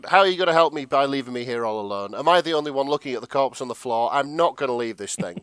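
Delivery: acted-out rising fluster, easing at the end; panicking. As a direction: rattle it off in comic alarm. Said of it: "Jack's still panicking".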